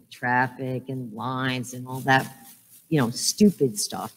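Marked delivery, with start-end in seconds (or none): none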